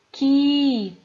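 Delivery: "Qui" punched out loud and held; stressed syllable demo. Shown as a demonstration. Teacher answering which syllable is tonic, "Qui" loud and emphatic. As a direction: hit "Qui" hard as a lone stressed syllable. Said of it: "qual é a sílaba tônica".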